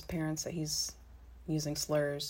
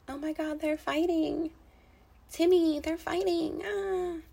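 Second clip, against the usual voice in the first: much higher, frantic parent's voice; panicked mom; 11 semitones higher, slower than usual. Retold or imitated. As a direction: squeal it out like a panicking mother.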